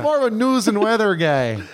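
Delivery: folksy Midwest repeat of the line; mock Midwest accent. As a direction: savor the Midwest lilt again. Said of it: "that real Midwest".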